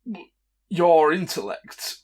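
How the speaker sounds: slow, deliberate insults; vicious mockery. Bard casting a mockery spell on a stranger, slow scornful taunt. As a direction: draw out each insult with theatrical scorn. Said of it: "the vicious mockery cantrip on him".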